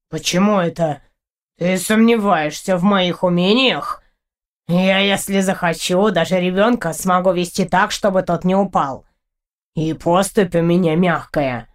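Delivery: low creature voice, offended pride; proud cat character, affronted. Acted. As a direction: boast of your skills in a deep, unhurried voice.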